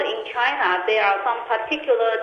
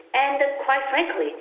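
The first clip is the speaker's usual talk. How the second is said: ordinary talk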